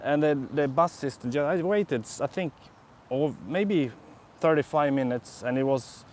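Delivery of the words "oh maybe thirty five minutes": ordinary talk, nothing marked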